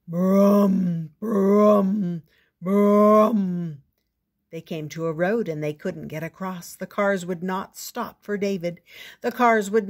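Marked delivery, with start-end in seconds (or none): 0.1-3.8 loud booming brum of a giant; giant footsteps. Acted. Giant father coming to the kitchen, loud booming. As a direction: boom each brum like heavy steps. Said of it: "and the father went".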